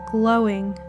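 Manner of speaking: usual speaking voice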